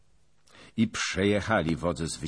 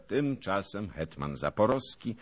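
plain reading voice

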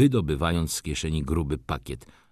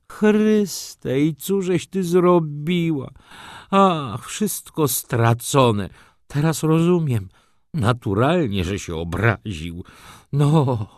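despairing; 8 semitones higher, 3 dB louder, slower than usual. A narrator's reading. Tomasz despairs over lost deal.

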